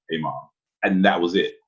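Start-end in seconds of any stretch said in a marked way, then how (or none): 0.1-0.5 low, offhand bass; same greeting dropped into a deep voice; suddenly deep, matter-of-fact boy; voice after breaking. Retold or imitated. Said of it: "Next I was like".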